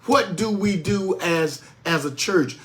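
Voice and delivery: ordinary delivery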